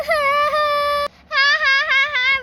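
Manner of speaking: put-on high, tearful wailing child; a little girl breaking into sobs over her lost doll; crying child. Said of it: "रोने की आवाज़ सुनकर". (रोती हुई बच्ची)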